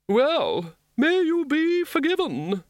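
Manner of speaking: high, indignant churchman voice; stout indignant cleric. A narrator's reading. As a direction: pronounce it like a pious blessing. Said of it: "said the Archdeacon of Throom stoutly".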